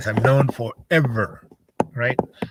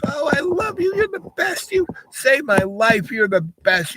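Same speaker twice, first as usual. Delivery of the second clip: very high, breathless gushing gratitude; gushing kid. Retold or imitated. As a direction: squeal it like an overjoyed kid. Voicing the grateful kid.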